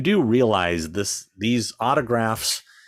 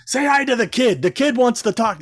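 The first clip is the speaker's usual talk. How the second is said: much higher and louder, calling out to the star; mocking the pushy dad; 5 dB louder than usual. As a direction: push your kid forward to get noticed. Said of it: "he keeps".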